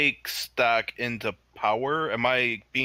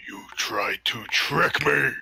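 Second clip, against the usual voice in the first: high, slow, indignant accusation; angry accusation; 5 semitones higher, 3 dB louder, slower than usual. Acted. Angered warrior accusing the spirit of trickery, indignant. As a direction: draw out the outrage at being tricked. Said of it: "he's looking visibly angry now".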